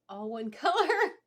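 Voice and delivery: laughing through the correction. Real, not acted. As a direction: giggle mid-word at the slip. Laughs at own word slip.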